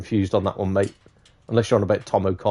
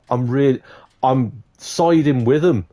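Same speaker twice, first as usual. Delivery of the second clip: ordinary delivery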